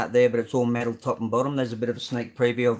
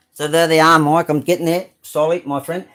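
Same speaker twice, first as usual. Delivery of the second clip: much louder, higher, triumphant; loud and proud; 4 semitones higher, 8 dB louder than usual. Real, not acted. Showing the repainted cars to viewer Mike, loud proud announcement.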